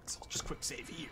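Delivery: hushed whispered aside. Real, not acted. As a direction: whisper it under your breath. Hushed aside while saving.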